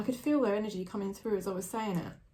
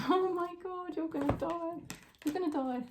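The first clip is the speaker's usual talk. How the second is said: astonished; 11 semitones higher than usual. Real, not acted. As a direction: react in real high-pitched disbelief. Astonished by card that reappears.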